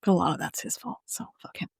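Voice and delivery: hushed, whispered dissent; whispered aside. Real, not acted. Co-host objecting that it's his own fault, whispered. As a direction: whisper it under your breath, very soft at the end. Muttered dissent about the character.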